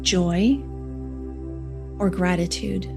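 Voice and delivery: soft, slow meditation voice; guided meditation. Acted. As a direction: guide them gently into calm, unhurried. Leads a heart meditation.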